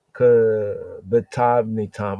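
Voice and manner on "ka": ordinary talk, nothing marked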